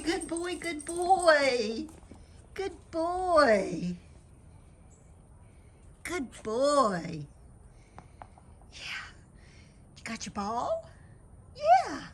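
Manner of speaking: high, sing-song pet voice; talking to a dog. Real, not acted. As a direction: coo to the dog, high and bright. Greeting her dog Biscuit at home.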